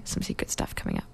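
hushed, letting out a secret; secretive whisper. Real, not acted. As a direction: whisper it like a teaser. Teasing secret upcoming projects.

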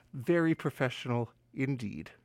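low, quiet dry self-mockery; deadpan mutter. Real, not acted. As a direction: mutter it dryly at yourself. Self-mocking repeat after a fumble.

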